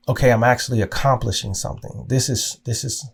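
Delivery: usual speaking voice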